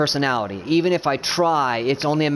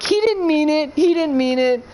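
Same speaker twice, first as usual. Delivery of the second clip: high pleading excuse-making wife; mock meek wife; 11 semitones higher than usual. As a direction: whine it like a wife covering for him. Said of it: "when you're like".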